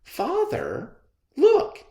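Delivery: put-on high child's call, slowing at the end; child calling to his father in the crowd. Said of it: "a young child called out".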